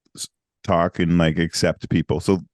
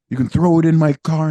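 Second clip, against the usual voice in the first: excited guy; 9 semitones higher, 7 dB louder than usual. Retold or imitated. Voicing the Norwegian guy.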